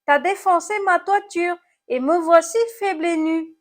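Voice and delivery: high, loud snail lament; moaning complaint. A narrator's reading. Snail blames apple for its smashed roof, moaning. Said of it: "gémit l'animal cornu".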